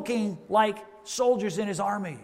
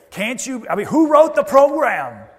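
higher, louder indignant complainer; louder, higher mock gripe about who wrote the program; mock complaint; 6 semitones higher, 10 dB louder than usual. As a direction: play the outraged churchgoer. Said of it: "Somebody could say".

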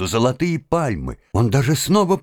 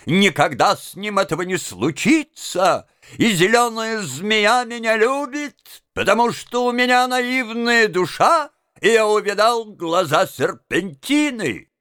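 higher-pitched fervent declaration; crying out; 6 semitones higher than usual. A narrator's reading. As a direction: insist fervently in a raised pitch. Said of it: "кричал студент Ансельм". (пылко восклицая)

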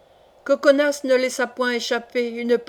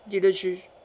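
ordinary delivery

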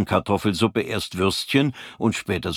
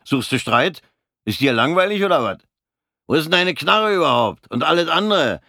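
raised, higher angry snap back; briefly loud; 8 semitones higher, 4 dB louder than usual. Acted. Fritz snaps back loudly at the taunting corporal. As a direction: push back hard, suddenly loud. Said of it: "Fritz wurde kurz laut".

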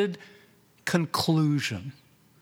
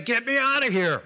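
a higher, pleading cry to be let out; pleading patient; 7 semitones higher, faster than usual. Retold or imitated. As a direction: beg to be let out of the machine. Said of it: "I wanted to say".

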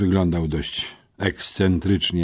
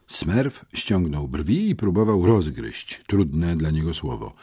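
ordinary delivery, nothing marked

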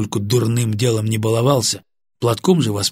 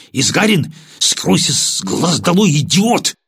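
angry dismissal; 6 semitones higher, 4 dB louder than usual. Acted. Lieutenant dismisses sergeant angrily.

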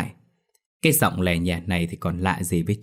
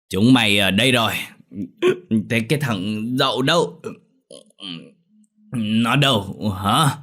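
put-on drunk father; 2 semitones higher than usual. A narrator's reading. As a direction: drag the words out, swaying drunk. Drunk father questions boys.

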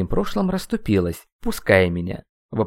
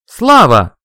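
very loud, higher-pitched call; full-voice shout; 7 semitones higher, 14 dB louder than usual. A narrator's reading. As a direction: yell the name at the top of your voice. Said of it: "крикнул я во весь голос". (крик во весь голос)

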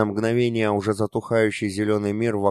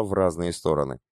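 plain reading voice

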